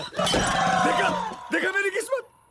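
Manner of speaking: very high, loud outburst of self-pity; despairing wail. Acted. Man lamenting his bad luck, wailing. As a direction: wail it out at the top of the range.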